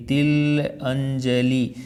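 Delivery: तिल अंजलि spoken slowly, each part drawn out; तिल … अंजलि stretched apart slowly; slow split. Shown as a demonstration. Teacher slowly breaking a word down for students. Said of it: "तिलों की अंजलि".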